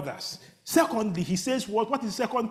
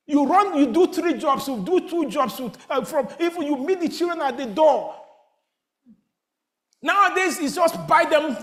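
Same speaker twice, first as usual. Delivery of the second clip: high, loud urgent preaching; preaching at full force; 5 semitones higher, 5 dB louder than usual. Acted. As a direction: press the congregation hard, rising. Preacher exhorting the congregation.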